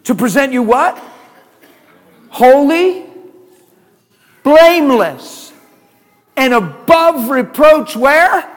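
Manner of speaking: ringing sermon call; preaching call. Real, not acted. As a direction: draw each word out to the crowd. Preacher calls out verse.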